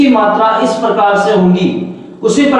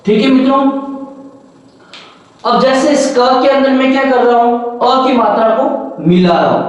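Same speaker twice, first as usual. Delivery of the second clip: ordinary talk, nothing marked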